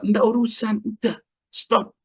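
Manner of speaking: high-pitched, dismissive cut-off; firm refusal. Acted. Acted refusal of an excuse.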